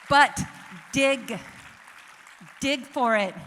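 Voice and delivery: loud, punchy command; rousing call. Real, not acted. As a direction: fire them up to act. Exhorting audience.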